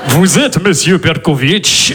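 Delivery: slow menacing Russian gangster; Russian accent. Pretend. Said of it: "Je fais bien l'accent comme ça". (accent russe grave et lent)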